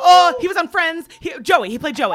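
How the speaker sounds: high, loud rush of excitement; frantic excited clue. Real, not acted. Player giving a Friends clue under the buzzer, excited and loud. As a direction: blurt it out loudly before time runs out. Racing clues against the buzzer.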